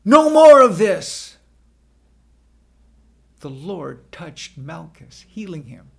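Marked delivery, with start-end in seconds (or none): 0.0-1.4 high, very loud command to stop; commanding cry that halts a fight. A narrator's reading. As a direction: shout it with full force. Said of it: "Jesus cried out".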